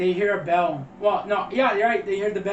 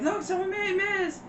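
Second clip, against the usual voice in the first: high-pitched imitation of a movie character; mock alarmed outcry; 13 semitones higher than usual. Voices movie character announcing the mess.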